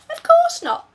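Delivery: put-on a puzzled little girl; girl's voice.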